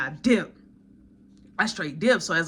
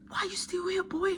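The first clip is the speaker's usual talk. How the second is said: high, mock-stern voice of a woman; high incredulous scolding; coworker scolding; 8 semitones higher, 8 dB quieter than usual. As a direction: scold him like an older coworker. Coworker scolds him staying late.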